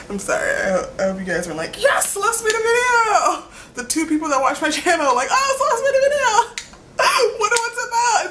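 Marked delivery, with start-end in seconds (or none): 1.8-3.4 high, slow, squealing cheer; mock excited viewers. Viewers cheering for a new video, hammed-up excited squeal. 5.3-8.2 high squeal through a laugh; mock excited viewers. Her two viewers cheering on a video, laughing squeal.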